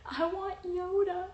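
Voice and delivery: high, slow, drawn-out whine; mock whine. Patient begging mom for her dog, high mock whine.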